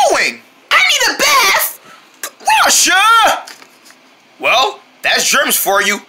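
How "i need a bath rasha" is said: high squeal. Acted. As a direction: run off squealing. Girl fleeing germs.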